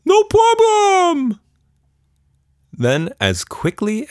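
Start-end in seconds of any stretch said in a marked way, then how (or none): 0.0-1.4 very high, loud, slow squeaky shout; cheery monster shout. A narrator's reading. Creature happily misunderstanding Tilda, squeaky shout. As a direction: shout it gleefully, clueless.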